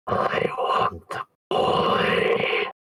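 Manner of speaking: drawn-out, voiceless demon rasp. Retold or imitated. Retelling the demon pointing at the boy, slow voiceless demon voice. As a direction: hiss it very slowly, all breath, like a demon.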